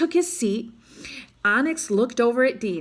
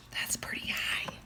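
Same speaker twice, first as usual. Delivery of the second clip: hushed aside to listeners; whisper; 13 dB quieter than usual. Real, not acted. Reader whispering to the children about the leap, a hushed whisper. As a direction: whisper it in awe. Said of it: "reader's whispered aside".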